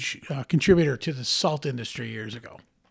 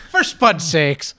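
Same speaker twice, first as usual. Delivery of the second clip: mock Irish; 2 semitones higher, 6 dB louder, much slower than usual. Keeps the Irish bit going.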